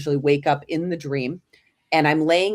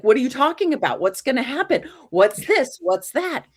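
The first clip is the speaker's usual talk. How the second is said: high, louder panicked questions; freaking out; 9 semitones higher, 4 dB louder than usual. Retold or imitated. Granddaughter frightened by her grandfather's warning, panicked high questions. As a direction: rattle off the questions in alarm. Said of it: "I'm freaking out".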